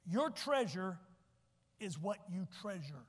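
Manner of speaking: slow, deliberate, spaced out; a measured slow teaching delivery; said slowly. Shown as a demonstration. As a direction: lay it out slowly for the slow learners. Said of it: "I'll say it slow for you homeschoolers".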